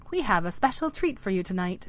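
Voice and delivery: plain reading voice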